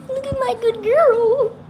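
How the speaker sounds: high, drawn-out coo; cooing pet talk. Real, not acted.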